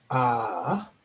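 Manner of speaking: usual speaking voice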